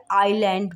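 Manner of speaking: आइलैंड with a silent s, "ailɛɳɖ"; correct island. Shown as a demonstration. Teacher gives the right आइलैंड after the wrong one. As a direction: say आइलैंड with no s sound at all. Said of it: "इसलैंड नहीं बोलेंगे".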